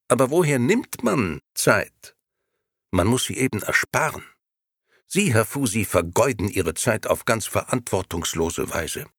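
the grey gentleman working on the barber, lightly voiced; grey agent's pitch. A narrator's reading. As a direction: talk him into it like a smooth agent. Grey agent keeps persuading.

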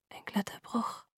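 barely-there whisper. A narrator's reading. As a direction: whisper it as if the lips hardly move. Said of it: "flüsterte ich, ohne die Lippen zu bewegen". (geflüstert, fast ohne Lippenbewegung)

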